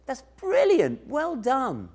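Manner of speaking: high, slow, beaming praise; smiling praise. Pretend. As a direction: beam at a child's drawing. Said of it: "He smiles and says".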